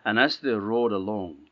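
plain reading voice

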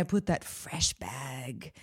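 hushed, breathy confiding whisper. Real, not acted. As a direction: whisper it like a guilty secret. Savoring the fresh bag moment.

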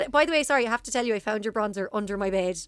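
ordinary delivery

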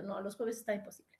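breathy whispered excuse, barely voiced; whispered refusal. Turning down a friend's invite, whispered.

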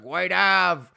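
put-on loud drunk heckler yell; drunk shout. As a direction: bellow it out like a drunk. Drunk heckler shouting city names.

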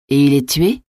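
mildly alarmed question with sharp final rise; understated shocked question, not shouted; exclamation. A narrator's reading. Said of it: "s'écria La Mole". (question saisie, qui monte à la fin)

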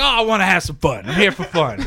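carefree quote. Retold or imitated. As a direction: say it like it's obvious and fun. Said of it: "He's like".

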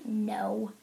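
low, quiet, stern refusal; curt annoyance, kept quiet; stern, annoyed. Retold or imitated. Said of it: "stern, annoyed look on my face".

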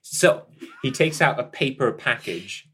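usual speaking voice

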